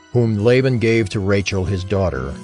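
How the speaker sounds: ordinary talk, nothing marked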